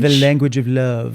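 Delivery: mock French accent; hammed-up French delivery; fake French accent. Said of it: "just because you say it like that".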